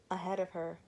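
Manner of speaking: usual speaking voice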